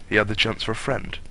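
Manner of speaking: usual speaking voice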